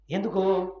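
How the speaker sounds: ordinary delivery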